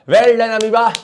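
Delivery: high, loud playful shout of praise; loud mock cheer. Teacher applauds the single-celled amoeba, loud mock cheer.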